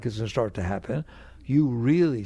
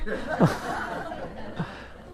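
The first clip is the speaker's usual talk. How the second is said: soft laugh; 6 semitones higher, 4 dB quieter than usual. Real, not acted. Laughs at own joke.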